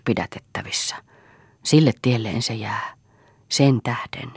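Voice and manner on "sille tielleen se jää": ordinary delivery, nothing marked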